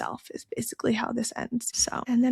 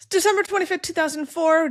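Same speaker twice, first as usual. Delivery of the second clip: announcing; 8 semitones higher, 6 dB louder than usual. Acted. Announcing the chapter date.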